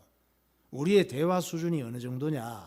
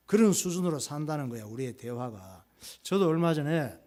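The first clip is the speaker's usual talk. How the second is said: usual speaking voice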